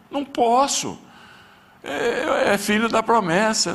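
high, strained pleading cry to God; anguished prayer. Retold or imitated. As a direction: beg as if the voice is breaking. Praying in tears for his son.

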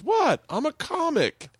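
high-pitched, incredulous self-defense; mock defensive. Hardcore Italian comic answers a shocked crowd, mock defensive.

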